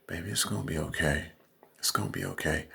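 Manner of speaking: put-on "Baby, it's gonna be okay" cooed quietly; softened sweet-talk voice. Shown as a demonstration.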